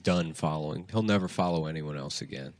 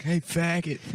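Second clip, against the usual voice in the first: prank caller; 9 semitones higher, slower than usual. Retold or imitated.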